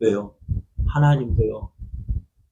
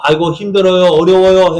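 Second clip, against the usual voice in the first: a loud, whining groan of complaint; loud moaning grumble, higher and louder; mock loud complaining; 4 semitones higher, 8 dB louder than usual. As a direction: wail about how hard it is, hammed-up. Israelites complaining, mimicked.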